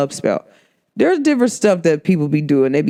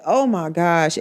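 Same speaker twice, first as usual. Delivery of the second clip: usual speaking voice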